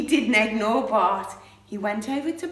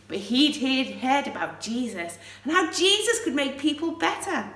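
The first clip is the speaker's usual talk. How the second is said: usual speaking voice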